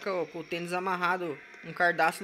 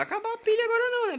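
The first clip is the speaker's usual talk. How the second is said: pleading squeak; 5 dB louder, faster than usual. Real, not acted. Pleading with the flashlight.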